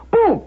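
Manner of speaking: loud, high-pitched vocal door slam; explosive boom. Retold or imitated. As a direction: burst out a sudden booming bang. Imitates door slamming open.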